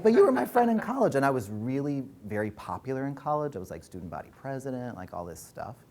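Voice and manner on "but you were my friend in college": much higher and louder, indignant; high, louder protest to her old friend; protesting in disbelief. Retold or imitated. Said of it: "And I was like".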